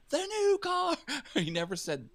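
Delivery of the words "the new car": high excited prize reveal; game show host. Retold or imitated. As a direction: announce it like the showcase. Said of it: "the word "that" was always before".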